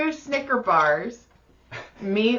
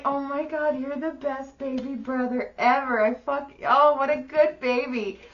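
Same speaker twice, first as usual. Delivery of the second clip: put-on higher cooing over the baby brother; gushing sister; 5 semitones higher than usual. The sister thanks her baby brother, mock cooing. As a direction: coo sweetly, a touch higher. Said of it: "And I was like".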